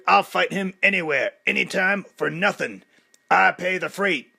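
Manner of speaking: loud, menacing challenger; a hint of a brash fighter calling out the champ; Clubber Lang. A narrator's reading. Clubber challenging Rocky at the dedication, aggressive.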